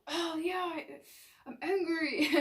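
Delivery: a light, laughing discovery; cheerful realization. Retold or imitated. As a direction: happily own up to the anger. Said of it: "You're gonna go".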